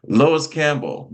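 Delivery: slow, high, loud "Lois Campbell"; grand introduction. Real, not acted. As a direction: announce her name like a host. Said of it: "my co-host".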